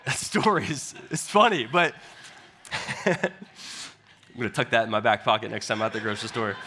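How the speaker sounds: chuckling through the words after the punchline; laughing. Real, not acted. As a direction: laugh while you talk, still amused. Laughs at his own joke.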